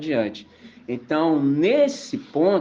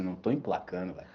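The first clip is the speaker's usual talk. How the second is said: much softer, deflated aside; quiet admission; 10 semitones lower, 12 dB quieter, faster than usual. Retold or imitated. The art student, giving up on the berimbau, quiet. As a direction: admit quietly that it isn't working. The friend admits defeat.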